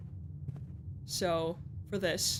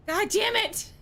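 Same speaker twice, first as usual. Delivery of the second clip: high-pitched exasperated curse; loud, high outburst; frustrated; 11 semitones higher, 4 dB louder than usual. Real, not acted. Another bad draw.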